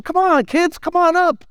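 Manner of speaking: put-on high, bright calling to the kids; playful Jesus. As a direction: call the children in playfully. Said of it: "Jesus was extremely playful with the children".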